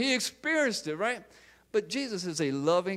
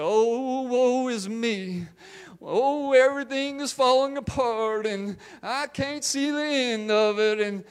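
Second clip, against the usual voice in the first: high, slow, woeful country wail; country accent; 5 semitones higher than usual. Acted. Preacher mimicking people bemoaning their lives, country-accent lament. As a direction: moan in a drawn-out country accent. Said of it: "I don't know why I had a country accent".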